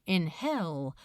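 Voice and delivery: ordinary delivery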